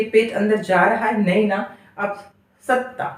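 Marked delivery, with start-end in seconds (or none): 2.6-3.1 सत्ता with half त held short into ता; correct half ta. Shown as a demonstration. Teacher modelling the correct सत्ता softly. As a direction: clip the first त in सत्ता, stomach pulls in. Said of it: "सत्ता बोलकर देखिए".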